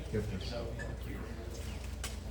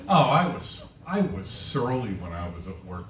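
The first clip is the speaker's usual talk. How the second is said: normal talking voice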